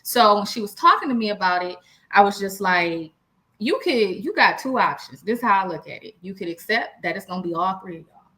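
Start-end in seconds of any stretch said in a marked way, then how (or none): none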